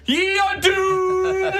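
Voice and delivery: high, slow shouted warning; doomsaying preacher. Acted. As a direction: cry doom to the crowd. Preacher shouts after departing player.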